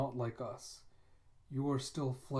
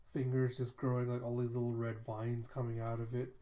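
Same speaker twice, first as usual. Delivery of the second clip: normal talking voice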